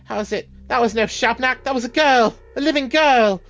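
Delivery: lightly British character, rising to an outcry; British accent. Acted. Townsperson protesting over a burned girl, light British accent. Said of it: "I don't know why they're all British".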